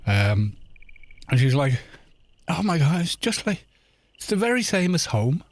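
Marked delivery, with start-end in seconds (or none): 2.5-5.4 put-on higher-pitched Southern Irish accent; Irish lilt, pitched up in imitation; imitated Irish girlfriend. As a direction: mimic a delighted Southern Irish lass. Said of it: "she was kinda like that".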